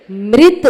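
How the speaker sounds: मृत said firmly with a clear ri; corrected mrit. Shown as a demonstration. Teacher to students, correcting मृत firmly. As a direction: stress the ri in मृत, loud and final. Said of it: "मृत नहीं".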